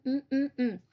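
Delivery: usual speaking voice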